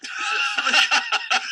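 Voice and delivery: high, loud breathy laughing; laughter. Real, not acted. Laughing at his own pillow joke, loud helpless laughter. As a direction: burst out laughing.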